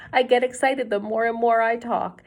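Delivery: usual speaking voice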